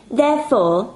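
put-on a girl making a grand decision; Shania's voice. Shania speaking.

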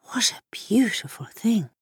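hushed, awed whisper; breathed softly. A narrator's reading. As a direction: breathe it out in wonder. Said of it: "breathed the boy".

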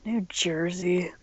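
breathy mock whisper. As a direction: whisper it like a secret.

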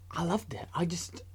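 breathy whispered confession. Acted. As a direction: whisper it like a secret delight. Whispered gush, then repeated plainly.

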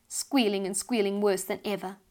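ordinary delivery

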